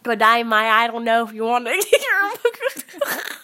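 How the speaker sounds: loud hammed-up Aussie accent cracking into laughter; Australian accent. Shown as a demonstration. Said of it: "show us your Australian accent".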